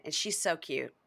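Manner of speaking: ordinary talk, nothing marked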